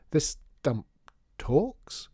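high, incredulous question; halting disbelief, climbing in pitch; surprised. A narrator's reading. Neighbour baffled by a talking door, surprised. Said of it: "sounding more surprised than miserable".

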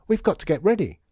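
high, loud and flustered; urgent. A narrator's reading. Lydia leaping from breakfast as customers queue, urgent. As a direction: hurry everyone along in a panic. Lydia rushing to get ready.

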